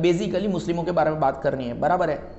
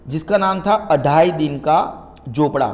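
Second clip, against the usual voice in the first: ordinary talk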